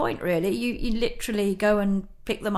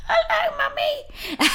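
put-on much higher, squeaky child's voice through a laugh; squeaky little girl; much slower than usual. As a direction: imitate a tiny girl who has lost her voice. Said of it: "So this little squeaky voice".

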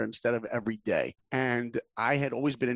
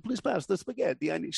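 higher, thick mock Russian accent; 5 semitones higher than usual. Husband asks wife for spaghetti in mock Russian accent. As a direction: ham up a Russian accent at dinner. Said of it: "I end up talking in that voice".

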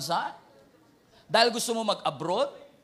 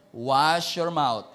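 slow menacing warning; 4 semitones lower, 5 dB louder, much slower than usual. Retold or imitated. Repeated slower warning.

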